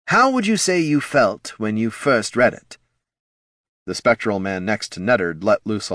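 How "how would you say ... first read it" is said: high, keen focus-group leader; high, eager marketing boss; head of marketing, facilitating. Acted. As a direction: prompt the group in a pitched-up executive voice.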